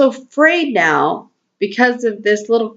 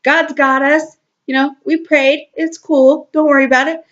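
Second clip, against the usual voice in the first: breezy spirit voice; 8 semitones higher, faster than usual. Pretend. Spirit soothing body and mind, high carefree reassurance. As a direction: sing out "it's okay" like a laid-back spirit, pitched way up. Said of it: "the spirit over there hanging out by itself".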